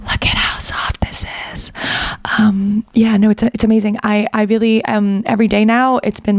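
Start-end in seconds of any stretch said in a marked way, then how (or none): 0.0-1.7 breathy whisper close to the mic; hushed ASMR murmur over a soft blanket. Pretend. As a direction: whisper softly as if for an ASMR video. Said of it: "can you do an A S M R on a blanket".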